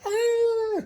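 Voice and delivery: a loud, high squeal; high mock shriek. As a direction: mimic their fighting cry. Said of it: "they would just be like".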